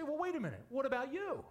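high, indignant objection; high incredulous heckle; mock objection. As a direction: pitch it up like a listener protesting. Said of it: "You say".

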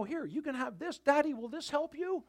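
imitating an earnest small child; eager little kid. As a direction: pipe up like a kid to Daddy. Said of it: "You know how kids are".

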